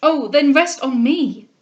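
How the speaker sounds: slow, loud, fervent plea; crying out. A narrator's reading. Groom holds his ailing bride, crying out. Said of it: "cried he, still holding her".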